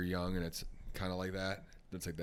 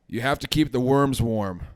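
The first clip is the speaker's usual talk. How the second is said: slow, loud, higher garbled voice; mouth-full punchline; 6 semitones higher, 18 dB louder, slower than usual. Acted. Ice-fishing kid tells grandpa the secret, slow and mouth-full. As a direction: labour each word round a full mouth.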